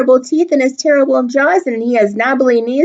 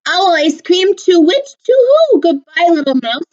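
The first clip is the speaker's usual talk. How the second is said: high hooting owl voice, much higher; 9 semitones higher than usual. Acted. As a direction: play a flustered owl, high and quick.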